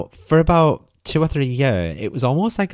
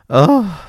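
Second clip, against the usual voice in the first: a loud, higher groan of disgust at herself; exasperated ugh; 8 semitones higher, 8 dB louder than usual. Real, not acted. Fed up with herself for being fooled, a loud disgusted ugh.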